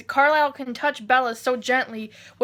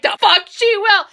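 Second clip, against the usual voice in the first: high, loud outburst; furious Edward; 6 semitones higher, 7 dB louder than usual. Retold or imitated. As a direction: spit it out in protest. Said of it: "and Edward's like".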